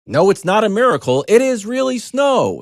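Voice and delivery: high, loud hammed-up sign voice; mock sign reading. Reading the Lake Placid sign to listeners: hammed-up loud. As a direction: boom the sign out like a slogan. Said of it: "the sign says".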